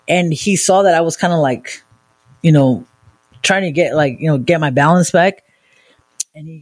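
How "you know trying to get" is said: usual speaking voice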